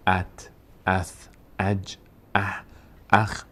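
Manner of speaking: ات، اث، اج، اه، اخ each as one closed syllable, vowel joined straight onto the final consonant; joined sakin sounds. Shown as a demonstration. Teacher showing learners how sakin letters attach, joined syllables ات، اث، اج، اه، اخ. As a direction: slowly demonstrate ات، اث، اج، اه، اخ, one separate syllable at a time. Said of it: "میچسبونیم".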